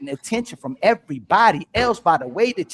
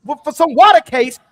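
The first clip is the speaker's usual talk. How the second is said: raised voice, high and loud; heated rant; 7 semitones higher, 8 dB louder than usual. Real, not acted. Debater calling out women's double standard, heated. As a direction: pile up the accusations loudly.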